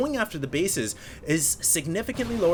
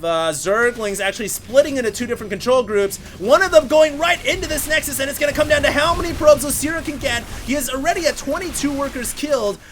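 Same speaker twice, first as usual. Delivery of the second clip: higher and louder play-by-play; excited; 7 semitones higher, 6 dB louder than usual. Real, not acted. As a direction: build the hype as the lings run in. Excited casting of harass.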